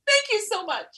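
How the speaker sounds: high, gushing gratitude; gushing thanks. Retold or imitated.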